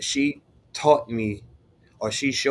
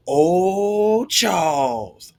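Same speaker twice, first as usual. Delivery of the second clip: sing-song teasing "Oh, Charles", higher and louder; teasing classmates; 6 semitones higher, 4 dB louder, much slower than usual. Retold or imitated. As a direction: tease him like the whole class did. Classmates teasing about her boyfriend.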